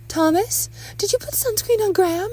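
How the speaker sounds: very high, loud wifely questioning; accusing mother. Acted. Wife questions husband about son's sunscreen, high accusing voice.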